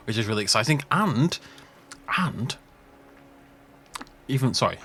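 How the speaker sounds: ordinary talk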